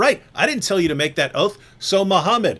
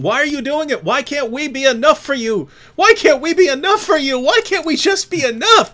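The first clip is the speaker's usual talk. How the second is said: high, louder whining wife voice; mock whiny wives; 9 semitones higher, 4 dB louder than usual. Speaker mimics nagging wives, high whine. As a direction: plead like a jealous wife, pitched up.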